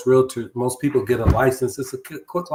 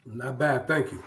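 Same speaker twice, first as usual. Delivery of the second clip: hard to hear; 6 dB quieter than usual. Real, not acted. Guest answering the host's greeting, hard to hear. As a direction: answer too quietly to carry. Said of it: "I can't hardly hear you".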